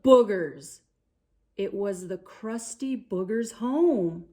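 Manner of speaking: storybook narration voice; reading to children. A narrator's reading. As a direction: read it like a bedtime picture book. Children's story read aloud.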